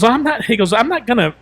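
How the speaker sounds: high, loud mock protest; retold refusal. Man refusing to apologize, high indignant retold protest.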